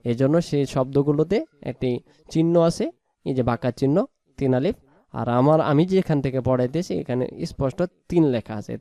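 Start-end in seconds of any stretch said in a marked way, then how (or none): none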